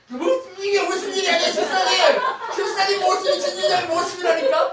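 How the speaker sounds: very high, loud mock yelling; hammed-up high-pitched scolding; acted exasperated outburst. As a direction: shout it in comic exasperation. Preacher acts out exasperated outburst, laughter.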